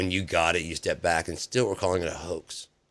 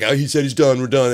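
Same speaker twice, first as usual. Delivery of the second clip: high, much louder mock cry; mock eager reporters; 6 semitones higher, 10 dB louder than usual. Reporters hurrying out after the president finishes, high and loud.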